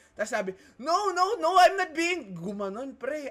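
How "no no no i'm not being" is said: high, loud indignant protest; raised voice. Retold or imitated. Opponent denying being passive-aggressive, imitated raised voice. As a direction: imitate someone losing their cool, pitch shooting up. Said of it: "tumaas na yung boses niya".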